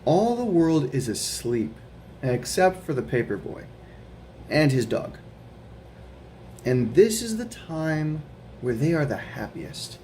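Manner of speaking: gentle storybook read-aloud; reading to children. Acted. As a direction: read it like a bedtime picture book. Reading picture book aloud.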